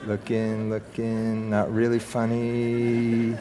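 low, drawn-out talking to himself; soft, slow aside to oneself; absent-minded murmur. Pretend. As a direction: murmur slowly as if scanning notes. Mock-reading notes to himself aloud.

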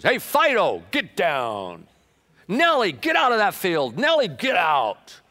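loud, high calls across the field to the animals; farmer Adam hollering. Acted. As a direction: holler at the dog and the cow from the tractor.

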